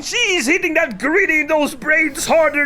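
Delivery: high, loud delighted holler; excited. Real, not acted. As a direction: cheer it like a rowdy spectator. Reacting to erotic dancing.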